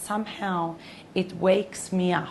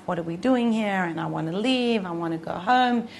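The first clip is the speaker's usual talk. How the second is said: ordinary talk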